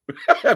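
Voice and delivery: short, high, loud burst of laughter. Real, not acted. Host amused at her own question, bursting into laughter. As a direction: break out laughing mid-question.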